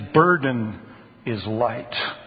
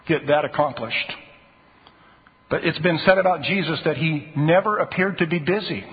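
normal talking voice

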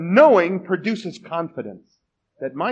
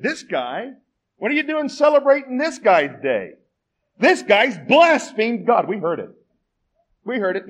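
accusing scoundrel; 7 semitones higher, 5 dB louder than usual. Retold or imitated.

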